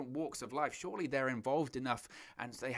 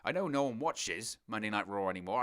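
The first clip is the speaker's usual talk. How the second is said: usual speaking voice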